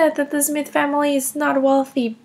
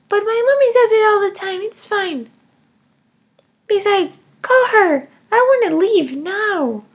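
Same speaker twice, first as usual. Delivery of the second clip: put-on higher, defiant little girl voice; child Emma; 4 semitones higher, 3 dB louder than usual. Kindergartner Emma defending taking her mom's money, higher child voice. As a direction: play a cheeky kindergartner justifying herself.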